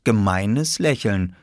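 usual speaking voice

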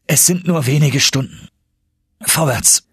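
high, rousing call; resolute cry. A narrator's reading. Nephew, moved by uncle's words, agrees, resolute cry. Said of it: "und deshalb rief ich".

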